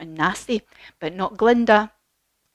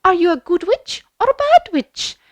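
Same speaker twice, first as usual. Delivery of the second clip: high, loud good-witch questioning; high, lilting storybook lady; Glinda; 10 semitones higher, 5 dB louder than usual. Acted. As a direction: question a stranger in a lofty fairy voice. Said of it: "And she said".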